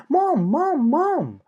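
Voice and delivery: put-on high, loud child's cry; panicked piglets calling mom; yelling for mom. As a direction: piglets screaming for their mother. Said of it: "they were yelling".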